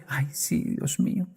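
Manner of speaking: soft whispered "Ay, sí, Dios mío"; a soft whisper of resignation; whispered sigh. Acted. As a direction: breathe it out like a resigned grandmother. Grandmother sighing at story end.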